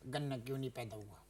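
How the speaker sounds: soft, low, calm question. Shown as a demonstration. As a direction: ask it quietly and calmly. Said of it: "अब आराम से मैं ठंडा होके".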